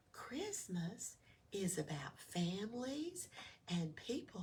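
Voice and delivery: soft, hushed kindly telling; gentle keepsake. Acted. Old ornament telling Oscar what Christmas means, softly. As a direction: explain it tenderly.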